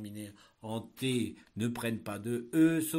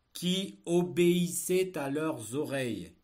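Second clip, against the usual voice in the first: dictating; 5 semitones higher, 8 dB louder, slower than usual. Shown as a demonstration. Teacher dictating a text.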